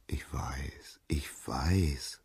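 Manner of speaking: hushed, breathy self-satisfaction; soft, self-absorbed murmur; smug murmur. A narrator's reading. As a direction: whisper it, basking in praise. Vain Narcissus basks in praise.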